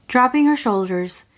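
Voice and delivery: plain reading voice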